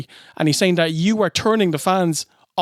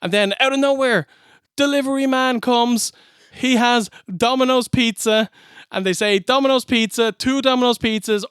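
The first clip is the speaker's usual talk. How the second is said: higher-pitched, relentless mock sales patter; mock-excited recap; 6 semitones higher than usual. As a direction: pile up the absurd repetitions with mounting exasperation. Piling up absurd pizza repetitions.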